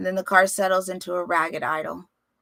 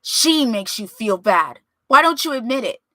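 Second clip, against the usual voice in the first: angry challenge; 3 semitones higher, 7 dB louder than usual. Acted. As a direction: push him to admit it. Chico confronting his father.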